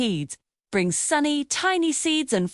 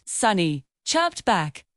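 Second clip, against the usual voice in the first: ordinary delivery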